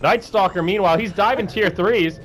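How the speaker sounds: high, lively play-by-play; excited casting. Acted. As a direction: call the fight as it happens. Live play-by-play.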